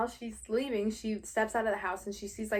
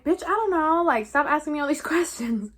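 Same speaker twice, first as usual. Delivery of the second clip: raised, loud mock exasperation; retold snap with a laugh creeping in; exasperated outburst; 6 semitones higher, 7 dB louder than usual. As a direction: act out a fed-up retort, louder and higher. Retold exasperated reply in a quarrel.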